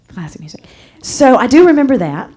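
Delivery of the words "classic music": whispered aside. Shown as a demonstration. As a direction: whisper classic music as an aside.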